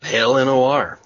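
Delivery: letters called out loud and high; loud spelled-out greeting. Real, not acted. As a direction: announce each letter.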